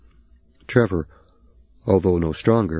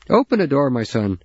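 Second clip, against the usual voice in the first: higher, louder calling voice from under the truck; 7 semitones higher, 3 dB louder than usual. Acted. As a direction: call across the yard.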